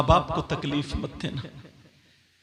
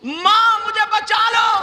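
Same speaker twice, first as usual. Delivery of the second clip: high, loud chanted cry for help; screamed plea; 9 dB louder, slower than usual. Acted. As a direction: cry out and hold the last note. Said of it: "लड़की चीखने लगी, चिल्लाने लगी". (चीख़ती हुई ऊँची पुकार)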